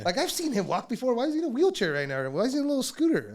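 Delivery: high, loud disbelieving rant; incredulous. Acted. Doubting his friend's wheelchair backstage, incredulous. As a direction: sound baffled and suspicious, pitch way up.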